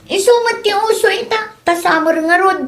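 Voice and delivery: a hint of a breezy teenage girl; daughter's voice.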